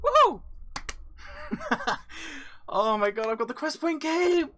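high, loud cry of real triumph; overexcited whooping. Real, not acted. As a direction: whoop and squeal with uncontained excitement. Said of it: "I'm so sorry if that was loud, I'm just too excited".

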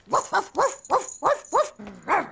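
playful barking. Acted. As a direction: pretend dog barks, woof woof. Child barking like Scruff.

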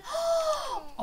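high, soft intake of breath; shocked gasp. Real, not acted. Reacts to boy walking away.

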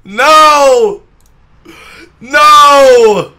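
loud, high desperate cry; desperate shout. Real, not acted. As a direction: cry out in panic. Character getting caught.

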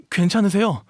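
high, loud, flustered question; alarmed concern. Acted. Startled dog boy to someone just bumped into, alarmed and concerned. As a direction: check on them in alarm.

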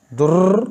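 दुर्र ending in a long rattling trill; over-trilled R. Shown as a demonstration. Teacher warns students off this reading, over-rolled दुर्र. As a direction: roll the R of दुर्र far too long. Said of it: "नहीं पढ़ना".

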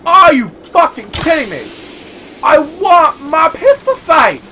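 loud, drawn-out furious demand; angry yelling. Real, not acted. Gamer yelling at the game, furious. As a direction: rage at the shopkeeper for the item.

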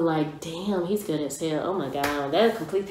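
ordinary talk

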